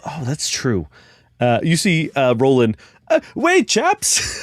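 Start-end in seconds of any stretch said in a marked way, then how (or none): 3.1-4.3 very high, thin little voice calling out; Roland's high voice. Acted. GM voicing Roland calling after the party, very high. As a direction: squeak it out as the small guide.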